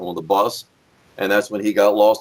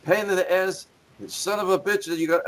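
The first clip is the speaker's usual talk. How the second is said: lightly voiced grumbling wrestler, raised pitch; higher, mock griping voice; Nikolai; 9 semitones higher than usual. Quoting the wrestler on his belt, lightly acted grumble. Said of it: "in fact, he said".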